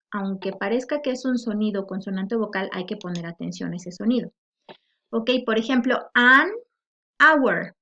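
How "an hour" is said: "hour" starting on its vowel, loud and clear; silent H. Shown as a demonstration. Teacher demonstrating silent H, "an hour" said loudly without it. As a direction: say "an hour" firmly with no H in "hour". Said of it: "la H no se pronuncia".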